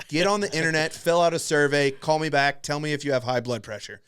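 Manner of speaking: loud hurried instructions. Retold or imitated. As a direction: bark the checklist at him. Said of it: "I'm like".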